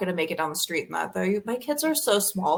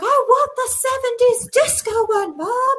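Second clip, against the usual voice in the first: high, loud mock kid whine; insistent child's demand, hammed up; imitating demanding kid; 6 dB louder than usual. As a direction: plead like a six-year-old, way up high. Imitating daughter demanding a costume.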